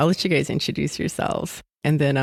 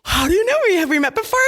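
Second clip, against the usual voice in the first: surprised; 13 semitones higher, 4 dB louder, faster than usual. Retold or imitated. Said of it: "And I was like".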